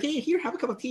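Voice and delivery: hosting voice, sing-song and kind; gentle hostess. Retold or imitated. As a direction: coax a guest gently into tea. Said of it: "It's very gentle when you do it".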